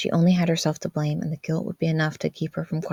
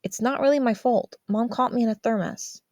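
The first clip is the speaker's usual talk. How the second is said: put-on higher, defensive teen's voice; a kid explaining it wasn't his fault; defensive teen boy; 6 semitones higher than usual. Brother answering his sister, a defensive teen voice.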